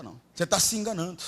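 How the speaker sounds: ordinary delivery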